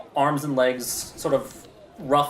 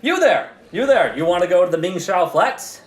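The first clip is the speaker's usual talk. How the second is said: high, loud street cry; hawker calling; 3 semitones higher, 5 dB louder than usual. Acted. One-eyed old hawker calling a girl over, high and loud. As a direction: grab a passerby's attention.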